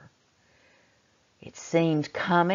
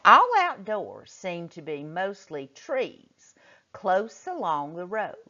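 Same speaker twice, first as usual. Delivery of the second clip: normal talking voice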